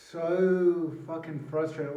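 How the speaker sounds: fed-up, loud venting; real frustration. Real, not acted. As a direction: let the frustration burst out.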